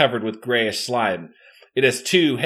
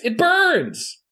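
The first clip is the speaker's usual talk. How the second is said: pained cry; 13 semitones higher than usual. A narrator's reading. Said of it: "she cries".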